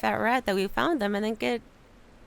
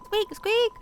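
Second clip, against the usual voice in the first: much higher, loud squeak squeak; rat squeaks; 11 semitones higher, 5 dB louder than usual. Acted. Rat guard squeaking at the human, high squeaks.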